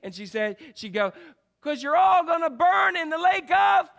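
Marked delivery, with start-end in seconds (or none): 1.6-3.9 much higher and louder, climaxing on "fire"; hammed-up preacher shout. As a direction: bellow the tagline at the crowd. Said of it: "this kind of tagline that she would say".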